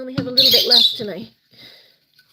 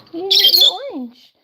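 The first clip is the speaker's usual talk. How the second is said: higher and louder mock scold to the birds; teasing pet scold; 9 semitones higher, 7 dB louder than usual. Real, not acted. Owner teasing birds for leaving their orange, loud high mock scold. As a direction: playfully tell off the birds, pitched up.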